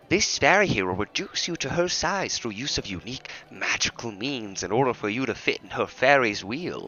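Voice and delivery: theatrical carnival guide, measured; grand showman voice. Acted. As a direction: present the ride like a proud showman. Atrebus voice, far timbre.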